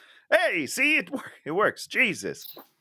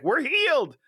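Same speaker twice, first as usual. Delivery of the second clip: mock lepers' line; 11 semitones higher than usual. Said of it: "she's like".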